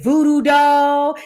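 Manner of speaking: drawn-out "Voodoo doll"; imitating her delivery. Host imitating how the singer said her name, drawn out. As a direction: stretch both words out long, mimicking her. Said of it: "It was the way she said".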